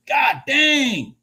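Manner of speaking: excited exclamation. Real, not acted. Trader reacting to a big move.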